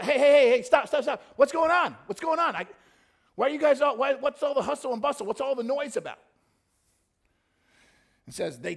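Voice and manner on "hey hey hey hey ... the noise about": high, loud, insistent questioning; frantic shouts, easing slightly by the end; urgent calling out. Acted. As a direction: holler at passers-by to stop and explain. Beggar stopping passers-by for news.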